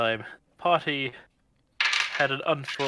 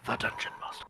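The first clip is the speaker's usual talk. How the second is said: dramatic whisper; 6 dB quieter, faster than usual. Acted. Grand whispered reveal.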